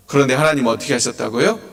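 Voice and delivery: usual speaking voice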